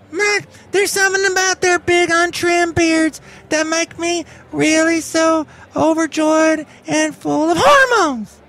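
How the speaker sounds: a sassy rodeo queen sweeping in with a drawled "y'all"; high-pitched comic character who greets everyone and loves hipsters; Benita the Rodeo Queen. Acted. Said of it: "It's Benita the Rodeo Queen".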